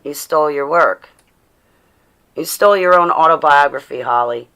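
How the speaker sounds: loud mocking accusation. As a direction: hammer the accusation home. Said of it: "That's the other one".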